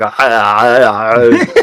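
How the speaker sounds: mock action-hero yell; imitated strained yelling "ah"s; Arnold Schwarzenegger. Client purging something from the body, hammed-up action-hero yell. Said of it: "Arnold Schwarzenegger".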